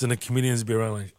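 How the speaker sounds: ordinary talk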